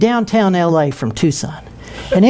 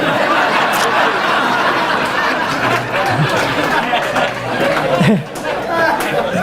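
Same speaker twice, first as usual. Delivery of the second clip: long unvoiced laughing; long breathy laugh at his own story; breathy laughter; 7 semitones higher than usual. Real, not acted. Laughing at the punchline.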